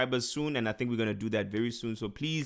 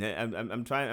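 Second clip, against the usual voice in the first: usual speaking voice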